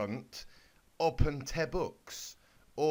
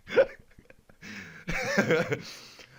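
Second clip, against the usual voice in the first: breathless laugh; 3 semitones higher, 8 dB louder than usual. Real, not acted. Reader cracks up.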